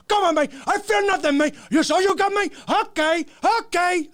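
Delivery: squeaky mocking imitation of Tony. Mocking Tony shrugging off gunfire, high. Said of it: "And he's like".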